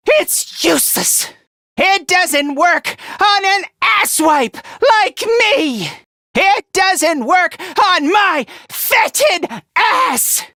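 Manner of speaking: high, emphatic battle yell; defiant shout. Acted. Telling a transformed foe it's useless, defiant shout. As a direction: punch it out word by word, defiantly.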